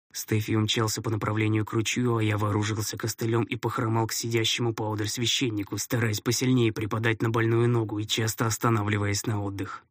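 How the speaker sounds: ordinary delivery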